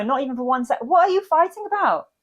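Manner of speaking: usual speaking voice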